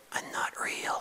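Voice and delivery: unvoiced secret whisper; whispered aside. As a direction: let the puppet confess in a whisper.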